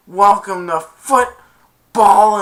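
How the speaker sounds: high, very loud, stretched-out shout; retold payback taunt. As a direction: throw the taunt back at full volume. Kicker throws taunt back.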